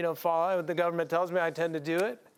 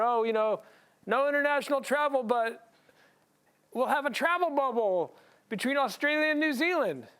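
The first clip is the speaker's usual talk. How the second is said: higher, lightly mocking official announcement; mock government spin; 6 semitones higher than usual. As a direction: parody the cheery government line, pitched up. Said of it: "The government tried to make it better".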